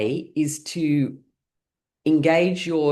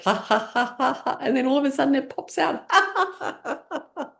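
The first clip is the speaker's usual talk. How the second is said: high laughter as the toast pops; laughing at the toaster; 11 semitones higher than usual. Shown as a demonstration. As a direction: laugh with sudden surprise. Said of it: "you're waiting for it".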